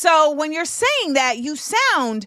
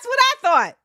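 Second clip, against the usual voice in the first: high mock retort; 11 semitones higher, 4 dB louder than usual. Shown as a demonstration. Laughing repeat, much higher.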